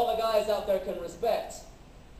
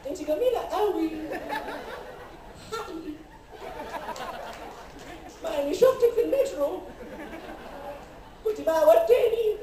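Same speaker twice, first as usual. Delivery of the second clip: [name] parody; 6 semitones higher, much faster than usual. Pretend. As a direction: play the sensitive singer begging men to sing to girls. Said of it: "[name]".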